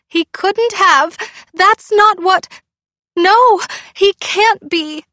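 high, loud, slow sobbing protest; crying denial. Acted. Girl refuses to believe her dog died, crying. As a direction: plead against the news through tears.

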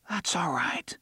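hushed dry whisper. A narrator's reading. Jake calming Oy at night, dry whisper. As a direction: breathe it out soothingly. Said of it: "Jake whispered in a dry voice".

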